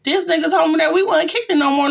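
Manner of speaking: indignant outburst; heated complaint. Retold or imitated. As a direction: call him out loudly. Heated retold argument.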